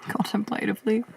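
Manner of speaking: CONTEMPLATIVELY as a quiet, amused question with rising end; questioning her word. Real, not acted. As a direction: query "Contemplatively" softly and quickly, voice lifting at the end. Doubts her own word choice.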